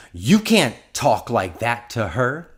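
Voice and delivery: retold scolding, punched out loudly; indignant rebuke. Man confronts speaker over coworker.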